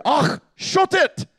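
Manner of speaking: high, drawn-out Scottish exclamation; exasperated Scot with a throaty "ach"; slow it down and pitch it high in Scottish; Scottish accent. Acted. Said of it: "put that in Scottish for you".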